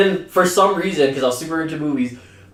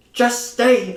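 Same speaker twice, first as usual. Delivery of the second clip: high-pitched imitation of a stern mom; exaggerated mom voice; 8 semitones higher than usual. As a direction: put on a high, bossy mother voice. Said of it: "she don't sound like that".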